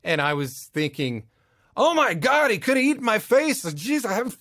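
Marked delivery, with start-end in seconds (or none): 1.8-4.4 much higher and louder, panicky shock; realizing the dog bites, alarmed; horrified realization. Retold or imitated. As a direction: gasp it out as it hits you he could've bitten.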